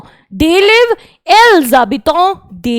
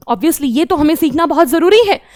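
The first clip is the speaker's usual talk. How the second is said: normal talking voice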